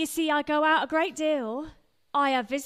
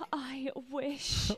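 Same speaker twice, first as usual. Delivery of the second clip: soft, wistful whisper; 5 dB quieter than usual. Acted. Wife echoing her wish, whispered. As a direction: barely breathe it, longing. Wistful repeat under breath.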